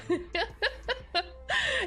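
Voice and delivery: high giggle; laugh. Real, not acted. Streamer forgot she set up the alert, laughing. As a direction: giggle at your own forgetfulness.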